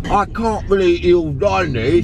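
slow, drawn-out villainous British voice; evil British man. Shown as a demonstration. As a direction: hiss the line like a gloating British villain. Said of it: "my evil British man version".

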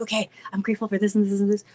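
very quick, running items together; rattled-off list. Acted. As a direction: reel it off without pausing.

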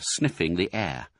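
ordinary delivery, nothing marked

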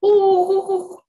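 high, loud owl whinny; screech owl call. Shown as a demonstration. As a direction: do the screech owl without spit. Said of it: "I can almost do it without the spit".

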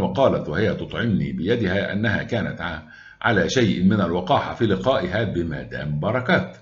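usual speaking voice

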